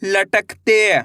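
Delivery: slow, separate syllables, then the word drawn out; patient teacher dictating syllables; syllable by syllable reading. Shown as a demonstration. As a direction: spell each sound for beginners, then blend.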